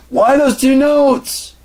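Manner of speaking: very high loud and slow; mock shriek. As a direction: stretch it out in a squealing wail.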